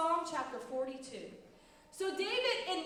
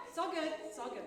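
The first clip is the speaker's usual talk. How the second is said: mock calm; faster than usual. Teacher acting out recovering from a sock crisis, mock calm. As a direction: let it sink down, soothing yourself. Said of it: "we're like".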